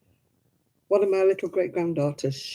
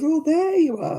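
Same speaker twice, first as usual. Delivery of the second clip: higher-pitched greeting in another's voice; imitated daughter-in-law; 10 semitones higher, 4 dB louder than usual. Daughter-in-law arriving to rescue great-grandmother, imitated high greeting. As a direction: act out finding someone, pitched up. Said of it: "she goes".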